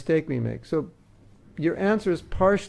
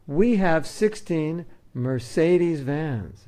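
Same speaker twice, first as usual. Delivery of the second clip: slow, louder, every word spelled out with pride; proud boast; 3 semitones higher, 5 dB louder, much slower than usual. Retold or imitated. Temple manager boasting about vans to a visitor, slow and proud. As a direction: brag about "sixteen Mercedes vans" slowly. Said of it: "and I say".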